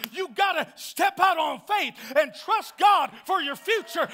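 loud, rising preacher's cry; full-force preaching. Acted. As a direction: preach it out, building and pushing hard.

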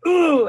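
a hammed-up exasperated groan; mock exasperated ooh. Parent mimicking a frustrated reaction, mock exasperated ooh. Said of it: "your face isn't like".

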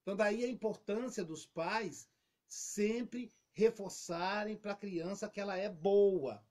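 normal talking voice

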